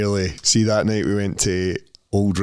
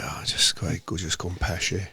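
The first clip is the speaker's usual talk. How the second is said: hungover mumble; 6 semitones lower, 8 dB quieter than usual. Retold or imitated. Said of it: "He's like".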